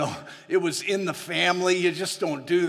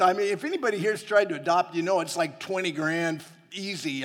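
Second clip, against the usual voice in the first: ordinary talk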